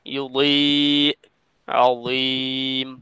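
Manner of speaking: ইউদি and আযীম with vowels held very long; long madd recitation. Shown as a demonstration. Quran teacher demonstrating a long madd to his class. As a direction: draw out আযীম slowly like tajweed recitation. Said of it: "এটা বেশি লম্বা".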